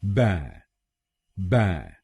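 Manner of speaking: बै alone, open "ba" without L; isolated, falling बै for learners to copy; syllable demo. Shown as a demonstration. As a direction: model बै as a separate syllable. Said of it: "हम बोलेंगे".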